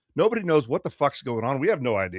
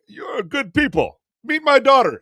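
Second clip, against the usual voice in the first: mock-enthusiastic, loud high proclamation; hearty driver; 11 semitones higher, 7 dB louder than usual. Driver proudly welcoming new passengers, hearty.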